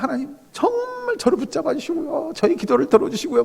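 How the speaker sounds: high, hushed imploring prayer; earnest pleading. Acted. Believer begging God to hold on to them, pleading. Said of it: "막 이렇게 간절히 매달렸어요".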